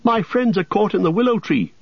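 high, urgent plea; a touch of panic; breathless cry. A narrator's reading. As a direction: gasp it out in a rush. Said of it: "cried Frodo, breathless".

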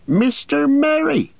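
relieved amazement; cry of surprise. A narrator's reading. Farmer recognises Merry, surprised cry. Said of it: "he cried".

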